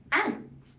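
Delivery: ant with a drawn short A, pitch rising high; short a sound. Shown as a demonstration. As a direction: stretch the A in ant. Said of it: "Can you hear the short A sound?".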